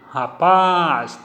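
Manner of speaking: Rapaz with PAZ stretched long; stress on last syllable. Shown as a demonstration. Teacher demonstrating an oxytone word, Rapaz with a long, strong PAZ. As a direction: lean hard on the final PAZ of Rapaz. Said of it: "Tem aqui a tonicidade na última sílaba".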